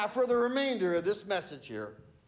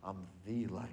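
"I'm the light" dropped much lower and softer; quiet restatement; 12 semitones lower, 10 dB quieter than usual. Shown as a demonstration. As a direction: let it fall to a near hush. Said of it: "Not a light".

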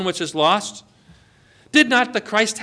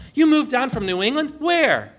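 surprised; 7 semitones higher than usual. Retold or imitated. Retold surprised reply to the stranger.

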